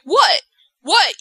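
high, short yelps of disbelief; mock startled. Miz facing the shrieking crew, mock startled. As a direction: imitate a man caught off guard. Retold shock at everyone's reaction.

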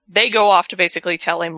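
usual speaking voice

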